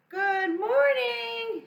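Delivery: high, loud, slow call out the window. Acted. Hen calling from her window to the animals, loud. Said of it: "she called out".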